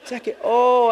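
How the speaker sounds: the penny drops, startled; realizing the nickname, sudden high surprise; surprised realization. Retold or imitated. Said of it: "And I'm just like".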